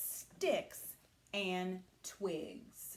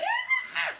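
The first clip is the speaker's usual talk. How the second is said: very high, loud squeal; pig squeal; 8 dB louder than usual. Acted. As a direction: squeal in fright like a piglet. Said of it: "He squealed and ran".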